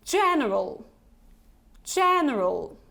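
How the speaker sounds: "general" with two quick schwas; model word. Shown as a demonstration. Teacher reading the word for learners to repeat. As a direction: say "general" with both middle and end vowels reduced. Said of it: "So once again".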